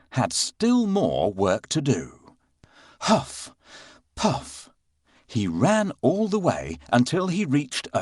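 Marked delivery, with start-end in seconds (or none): 3.0-4.7 breathy panting huffs; panting huff puff. Acted. Puss running out of breath.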